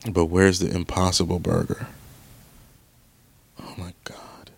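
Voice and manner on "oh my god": breathless whispered despair. Real, not acted. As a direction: barely breathe it out.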